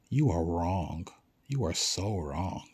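slow, low, deliberate contradiction; firm and drawn out; emphatic rebuttal. Real, not acted. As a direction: spell it out word by word. Firmly rebuts the imagined doubters.

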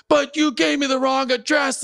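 high-pitched mock protest; hammed-up screechy accusation; imitated shouting tenant. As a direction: mimic an irate caller insisting at the top of the register. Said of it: "shouting match".

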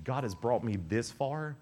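ordinary delivery

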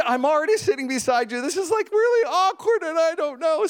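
high-pitched mock discomfort; awkward. Preacher replays sitting beside a crying man, awkward high voice. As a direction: retell awkward inner panic, voice up high.

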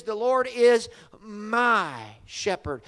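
drawn out, leaning on is; slow emphatic verse. Shown as a demonstration. Preacher to congregation, drawing out the verse slowly. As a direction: stretch The Lord is my shepherd slowly. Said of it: "and that is".

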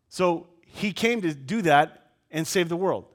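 usual speaking voice

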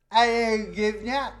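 high-pitched hammed-up British voice; mock British accent. Friend joining the governor joke, mock British accent. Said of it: "how do you know I'm British".

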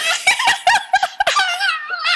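high shrieking laugh; real, long squealing laugh; helpless laughter. Real, not acted. Host cracks up at sister.

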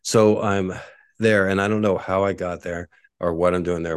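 ordinary talk, nothing marked

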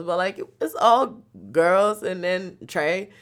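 ordinary talk

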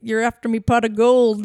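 loud mock-Irish leprechaun catchphrase; Lucky the Leprechaun. Friend recalling a cereal advert's mascot, loud leprechaun impression. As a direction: belt it out like a cartoon Irish leprechaun. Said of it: "Lucky the Leprechaun sounds like in the commercials".

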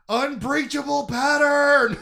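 high, slow, loud alarmed yell; technician yelling. Retold or imitated. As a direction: shout the warning like a panicked technician. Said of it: "a technician who's yelling".

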